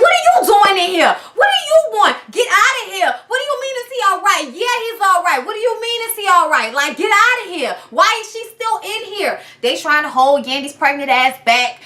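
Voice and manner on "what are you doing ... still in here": high, shouting demands to get out; mock furious Yandy. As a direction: yell at Remy to leave. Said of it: "And Yandy's like".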